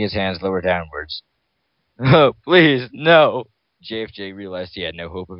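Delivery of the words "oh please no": high, loud frightened plea; plead, voice strained with fear; desperate begging. Acted. Victim begging in terror.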